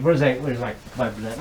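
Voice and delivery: ordinary talk, nothing marked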